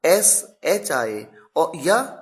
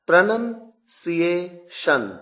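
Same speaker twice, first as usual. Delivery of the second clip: "Pronunciation" drawn out syllable by syllable; slow teacherly syllable-by-syllable reading; sounded out slowly; much slower than usual. Shown as a demonstration. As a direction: break "Pronunciation" into slow, separate chunks. Teacher sounds out the topic word.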